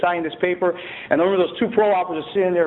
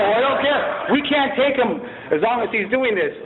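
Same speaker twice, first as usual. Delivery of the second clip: raised-pitch, exasperated officer; retold exasperated refusal; 6 semitones higher than usual. Officers refusing to take in the prisoner, retold in exasperation. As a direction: imitate officers putting their foot down.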